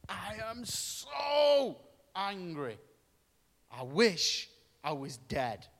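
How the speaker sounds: put-on slow, whiny teenage sulk; sulky Jonah. Jonah sulking at God, slow whiny complaint.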